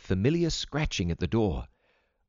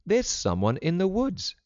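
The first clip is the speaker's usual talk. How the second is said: put-on higher-pitched girl reporting news; girl's first report; 10 semitones higher than usual. A narrator's reading. Vida tells her mother someone's in the woods, higher-pitched.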